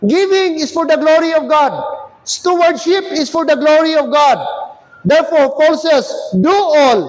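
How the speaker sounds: loud, high-pitched pulpit declaration; forceful preaching. Real, not acted. Preacher declares giving is for God's glory, forceful and ringing. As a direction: proclaim each phrase with punch.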